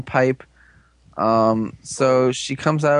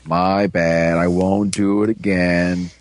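lower, slower sorry voice; sheepish apology; 7 semitones lower, much slower than usual. Retold or imitated. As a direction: act out the alien's meek apology, low and slow. Alien guy apologising to heroes.

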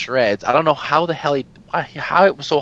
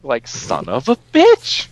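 real frustration; 4 dB louder, slower than usual. Real, not acted. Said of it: "I hate everything".

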